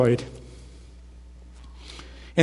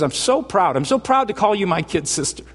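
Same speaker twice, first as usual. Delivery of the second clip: high-pitched, gushing big-brother pride; proud big brother; 6 semitones higher than usual. Acted. Jesus telling a believer he's proud of his kid sister, gushing. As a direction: beam with pride, voice lifted high. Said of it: "He looks at you and goes".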